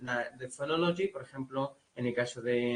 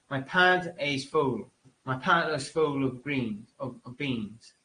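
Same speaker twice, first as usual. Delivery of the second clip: slow, hammed-up American drawl on "pant"; exaggerated American accent; 4 dB louder, slower than usual. Shown as a demonstration. Teacher exaggerating an American accent for the class. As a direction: stretch the vowels, overdo it. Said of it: "haciendo un poco exagerado".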